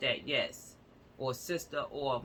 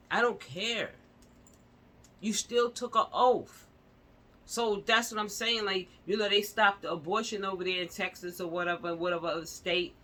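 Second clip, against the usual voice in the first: heated rant; 5 semitones higher, 4 dB louder than usual. Real, not acted. Ranting angrily about doctors' oath.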